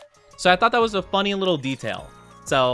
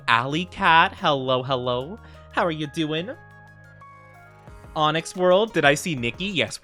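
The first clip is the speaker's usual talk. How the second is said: ordinary talk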